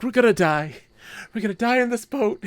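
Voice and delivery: high, hammed-up doom wail; mock panic. Player to companion on stormy boat, mock panic. Retells fearing death in storm.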